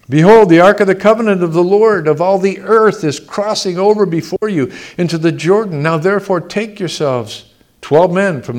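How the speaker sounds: loud, ringing Bible reading; scripture reading. Acted. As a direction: proclaim the verses to the congregation. Reads scripture aloud.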